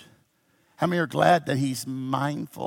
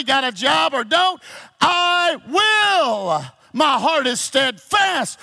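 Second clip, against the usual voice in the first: high, shouted sermon declaration; impassioned preaching; 10 semitones higher, 7 dB louder than usual. Real, not acted. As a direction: drive it home to the congregation with long, held stresses. Preaches at full force.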